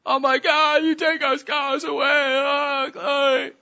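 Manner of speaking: high, loud mock wail; imitated crying. Minister reenacting his tears as scars vanish, imitated crying. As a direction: wail it out in joyful, hammed-up tears. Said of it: "And I'm crying".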